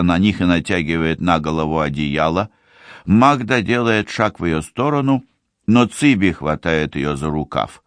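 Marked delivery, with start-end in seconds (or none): none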